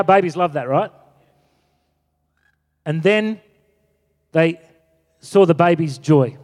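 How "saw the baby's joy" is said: ordinary delivery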